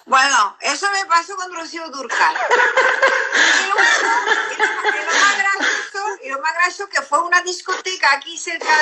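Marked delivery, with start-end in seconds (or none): 2.4-6.2 laughing. Real, not acted. Laughing while starting the punchline.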